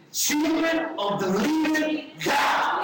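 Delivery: put-on high, slow, shouted proclamation of "Children of the living God". Shown as a demonstration. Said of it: "you gotta put more sound in this".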